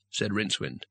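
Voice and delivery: plain reading voice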